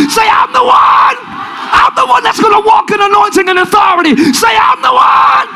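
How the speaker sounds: high, sustained revival shout; full-force preaching. Real, not acted. Preacher leading congregation's declarations, high full-force shouting. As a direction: shout each declaration for the crowd to echo.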